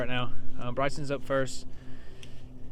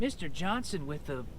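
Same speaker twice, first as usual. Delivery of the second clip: usual speaking voice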